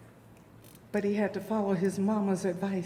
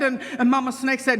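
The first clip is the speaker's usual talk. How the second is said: ordinary delivery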